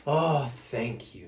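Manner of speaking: slow, grateful breath of relief; relieved sigh. A narrator's reading. Smelly bear thanking for a scratch, slow relieved sigh. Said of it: "Big Smelly Bear sighed".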